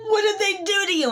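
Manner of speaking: high, fast, startled; mock shocked husband. Husband reacts to his wife's new look. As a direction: blurt it out.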